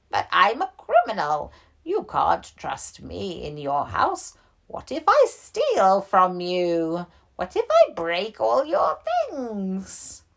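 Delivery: a bear who can't believe the offer; worried old bear. Acted.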